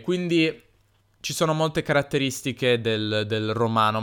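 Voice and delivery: ordinary talk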